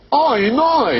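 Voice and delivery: loud, high "Oi, my" with OY vowels; oi diphthong. Retold or imitated. Off-camera advert voice seeing the rip, exaggerated oi cry. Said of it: "a voice off camera went".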